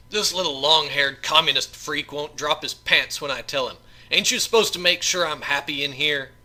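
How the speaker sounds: loud, jeering prisoner voice; loud sneering thug. Acted. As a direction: sneer it to the guard. Assassin complains to the guard.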